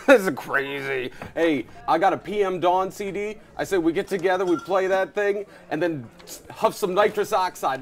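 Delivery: hammed-up party bro; goofy American bro. Brash American sketch character.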